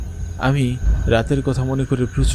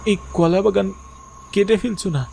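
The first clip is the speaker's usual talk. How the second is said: raised, much higher voice asking why; pleading question; 10 semitones higher than usual. Acted. Son asks mother why the banana garden stays, high and urgent.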